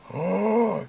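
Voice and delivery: sharp gasp of shock; sudden intake of air; gasp, caught off guard. Real, not acted.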